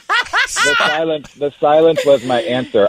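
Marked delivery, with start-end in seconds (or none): 0.0-1.3 slow, laughing through the words. Real, not acted. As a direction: laugh it out, drawn out. Laughing while answering a joke.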